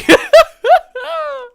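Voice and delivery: high loud burst of laughter; squealing laugh. Real, not acted. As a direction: break into giggles. Laughs at own distraction.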